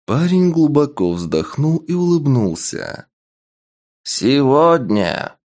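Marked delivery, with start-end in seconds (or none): 4.0-5.4 slow, booming call to the arena; booming proclamation. Acted. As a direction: proclaim to the whole crowd. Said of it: "Голос архижреца ... разнёсся по арене".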